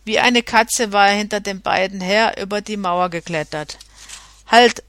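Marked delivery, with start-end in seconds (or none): 4.5-4.8 loud sharp shouted halt; one loud commanding cry. A narrator's reading. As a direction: bark it out like a threat. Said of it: "schrie er mit vorgehaltener Klinge".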